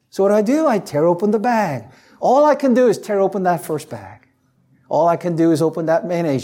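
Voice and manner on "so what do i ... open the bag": ordinary talk, nothing marked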